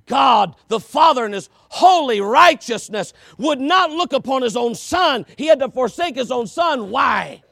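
loud, rising preacher's call; fiery pulpit preaching; preaching at full force. Acted. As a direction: build the questions to a shout.

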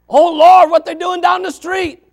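very high, loud hammed-up wail; exaggerated high-pitched fretting; mock alarm. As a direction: cry out like a scandalized church member. Voices an alarmed church member.

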